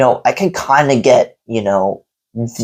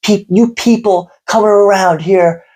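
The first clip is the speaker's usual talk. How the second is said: higher, mock furious rant at a customer; retold angry rant; 8 semitones higher than usual. Owner ranting at a customer, imitated high furious rant. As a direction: rant like he's losing it, pitched up. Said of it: "he was just going off and off".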